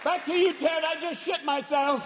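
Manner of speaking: high, strained TV presenter; freaked-out weatherman. Acted. Weatherman reporting a monster hurricane to viewers, freaked out. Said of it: "the guy in the Weather Channel is freaked out".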